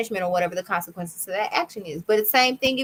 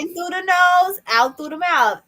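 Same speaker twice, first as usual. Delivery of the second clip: put-on child reciting; 8 semitones higher, 5 dB louder than usual. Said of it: "And he'd be like".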